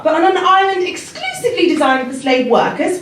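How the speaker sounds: ordinary talk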